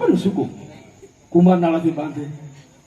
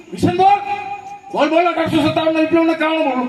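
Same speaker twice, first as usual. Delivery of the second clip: high and fast, a hammed-up teacher yelling the demand; mock teacher shout; mock angry teacher; 7 semitones higher than usual. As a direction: imitate the strict teacher losing patience. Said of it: "પાછું કીધું".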